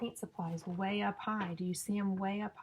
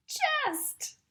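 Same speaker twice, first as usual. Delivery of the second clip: much higher, loud playful squeal; squealed punchline; 7 dB louder than usual. Acted. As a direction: squeal the rhyme word for the kids. Punchline rhyme word squealed high.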